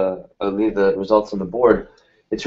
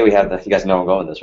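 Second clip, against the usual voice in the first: ordinary delivery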